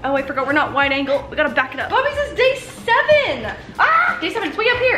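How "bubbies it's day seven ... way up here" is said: high sing-song pet talk; dog-talk voice. Real, not acted. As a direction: coo at the dog, pitched up. Talking to dog.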